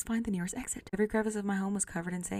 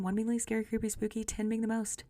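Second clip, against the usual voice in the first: ordinary talk, nothing marked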